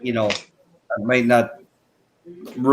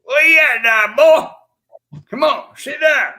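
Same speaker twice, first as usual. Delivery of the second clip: much higher, louder hammed-up West Virginia hillbilly holler; yelling like a mock West Virginia yokel calling folks over; bad West Virginia accent; 14 semitones higher, 6 dB louder than usual. Calling friends onto the chat, a loud, high mock West Virginia holler. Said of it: "That's a bad interpretation".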